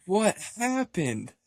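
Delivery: high, stretched-out astonished question; mock shock. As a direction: imitate stunned friends, dragging the words out. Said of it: "in shock. They're all like".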